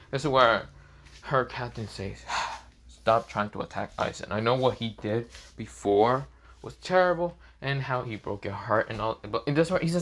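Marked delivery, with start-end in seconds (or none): none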